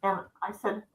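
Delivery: ordinary delivery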